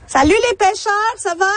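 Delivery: high-pitched hail across the water; calling out. Real, not acted. Reporter greets anglers out on the lake, calling out. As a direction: call out a greeting to people far off.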